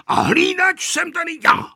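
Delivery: put-on high, insistent old man's yell; shouting. A narrator's reading. Grandfather shouting that he is the guard. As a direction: shout it to assert authority. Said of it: "křičel děda Kozelka".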